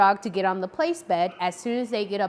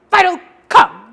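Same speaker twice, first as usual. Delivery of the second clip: "Fido, come" barked much louder and sharp; harsh call; 9 semitones higher, 14 dB louder than usual. Shown as a demonstration. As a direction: snap the name like the dog is in trouble. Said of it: "too harshly".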